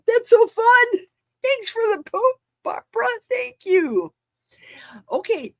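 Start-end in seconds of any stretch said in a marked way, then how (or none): 0.1-4.1 high, bright gushing thanks; high excited thank-you. Real, not acted. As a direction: thank her with giddy delight.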